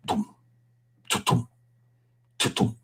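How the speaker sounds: low, soft drum thumps with the mouth; imitated drum beats. Storyteller imitates the boys' great big drum, soft drum beats.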